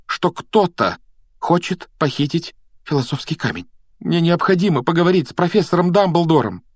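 high, insistent pupil; emphatic, building in force; urgent plea. A narrator's reading. Harry urgently pleads to see Dumbledore.

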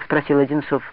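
ordinary delivery, nothing marked

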